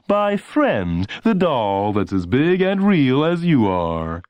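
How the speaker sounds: high, slow, gushing ad pitch; announcer voice. A narrator's reading. Announcer pitching Friend to lonely kids, slow gushing ad voice. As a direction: stretch it out like a TV pitchman. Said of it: "the announcer would say".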